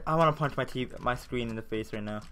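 usual speaking voice

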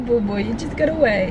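ordinary talk

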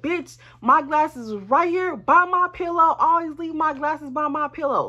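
high, heated retort; re-enacted angry comeback; going in on him. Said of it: "I'm going in on him".

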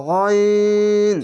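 গইন with rounded aw, no aa, held long; chant-like, drawn-out ghawin; thick letter. Shown as a demonstration. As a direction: stretch a heavy, level গইন. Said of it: "আকার উচ্চারণ নেই".

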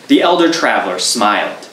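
ordinary delivery

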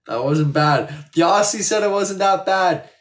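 loud, high, thrilled exclamation; triumphant and loud; delighted boast. Real, not acted. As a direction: crow proudly over the compliment, voice jumping up. Delighted at praise for the accent.

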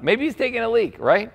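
high-pitched mock speculation. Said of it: "Elijah began mocking them".

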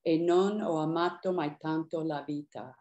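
E non ho amato mai tanto la vita spoken in Italian; Italian words. Retold or imitated. Poet quotes Paolo's aria line, spoken Italian. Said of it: "two lines of Italian in this poem".

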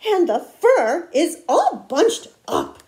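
slow, loud, choppy huff; indignant storybook mother bear; Mama Bear huffing. A narrator's reading. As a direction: punch each word in a huff. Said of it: "she huffed".

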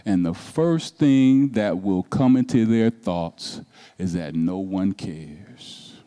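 hushed pastoral comfort; soft reassurance. Acted. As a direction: ease them down, quiet and tender. Reassuring the troubled congregation.

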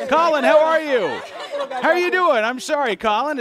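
excited apology. Real, not acted. Host forgot a guest, greets him.

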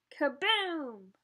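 playful splashy kaboom; splash kaboom. Acted. Reading to kids, dolphin splashing, playful kaboom. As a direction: make the splash big and fun.

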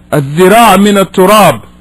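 ordinary delivery

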